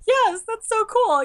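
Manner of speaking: high, loud, drawn-out cheer; thrilled. Acted. Cosplayer recognized in her Rey costume, thrilled. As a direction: stretch it out with delight. Voices delight at being recognized.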